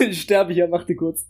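coughing, just choked on a drink; choking on a drink. Real, not acted. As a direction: talk through a coughing fit after swallowing wrong. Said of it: "Oh, ich hab mich voll verschluckt". (verschluckt, hustend sprechen)

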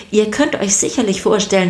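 ordinary delivery, nothing marked

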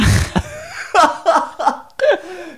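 a burst of high laughter; bright laugh. Real, not acted. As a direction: laugh out loud, amused. Host laughs at guest's reply.

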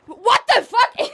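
high, slow indignant yell; outraged shout. Real, not acted. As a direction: shout it, offended. Caught picking nose, outraged.

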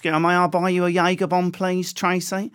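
high, loud Essex chat-up; Essex accent. Acted. Comedian chatting up Tracy in a bar, high Essex accent. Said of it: "And you got my accent".

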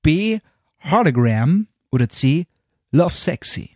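very slow, drawn-out answer read; slow quiz options. Acted. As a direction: spell out each option.

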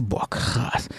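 low, hushed aside to himself; awed whisper. Retold or imitated. Dreamer reacting privately to the lawyer's victory, awed whisper. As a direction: whisper it in stunned awe.

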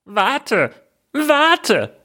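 very high frog voice calling after someone. Acted. Frog calling after the fleeing princess, high pleading call. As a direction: call out pitched far up, as a frog. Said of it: "rief der Frosch".

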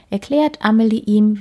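usual speaking voice

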